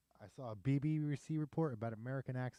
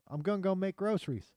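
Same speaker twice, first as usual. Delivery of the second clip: higher mock Pittsburgh accent, Southern-Midwest blend; 8 semitones higher, 4 dB louder than usual. Shown as a demonstration. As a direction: demonstrate a Pittsburgh accent, "groceries" as "groshries". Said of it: "kinda Southern and Midwest combined".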